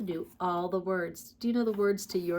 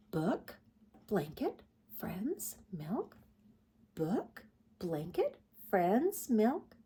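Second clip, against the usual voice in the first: quiet musing; 7 dB quieter, slower than usual. Acted. Mother bear listing, musing.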